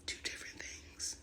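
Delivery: breathy aside whisper. Real, not acted. Punchline said under her breath.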